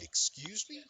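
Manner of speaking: an affronted challenge; indignant. Retold or imitated. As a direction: take exception, voice rising. Indignant retort to a rude comment.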